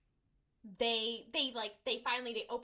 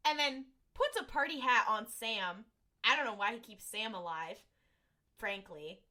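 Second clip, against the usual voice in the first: ordinary delivery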